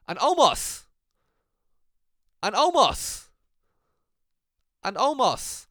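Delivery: loud, high swooping announcement; dramatic name reveal. Retold or imitated. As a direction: blurt the name like a mock ring announcer.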